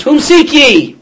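high and loud, calling out to the crowd; commanding challenge. Retold or imitated. Jesus confronts the arresting crowd, loud commanding call. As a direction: demand it of the mob, loud and high.

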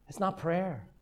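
ordinary talk

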